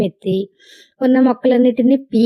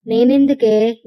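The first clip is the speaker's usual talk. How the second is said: usual speaking voice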